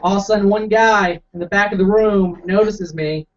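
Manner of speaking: ordinary delivery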